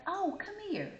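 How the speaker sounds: high, eager call to come over. Retold or imitated. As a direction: sing out to her friend. Child calling friend over.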